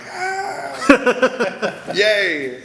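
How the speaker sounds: high, laughing little cheer; gleeful cheer. Retold or imitated. Answering the jealous actors backstage with a giddy, laughing cheer. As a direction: squeal it with a laugh. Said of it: "and I'm like".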